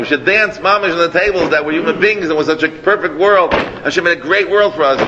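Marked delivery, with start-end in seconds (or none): none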